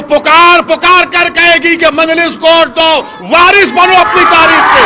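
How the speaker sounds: crowd-rousing roar; rising rally shout. Real, not acted. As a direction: swell up into a battle cry. Rally climax call to action.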